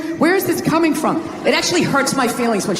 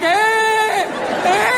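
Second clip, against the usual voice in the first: put-on whiny kid grunt; 8 semitones higher than usual.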